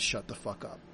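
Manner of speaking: low, quiet menacing "Shut the fuck up"; robber's threat. Retold or imitated. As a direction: say it like a mugger holding a pistol, quiet and cold. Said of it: "in the way that someone robbing you says".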